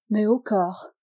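plain reading voice

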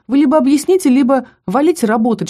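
loud, high scolding; angry. A narrator's reading. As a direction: tell them off sharply. Kara scolding the boys.

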